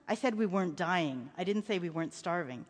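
low-pitched, measured correction; patient father. A narrator's reading. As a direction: explain it quietly to a child. Father corrects his son.

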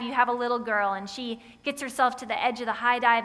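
normal talking voice

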